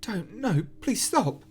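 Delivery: Sarah's voice haunting Phil, shrill frantic plea; frantic pleading. Acted. Sarah dying voice.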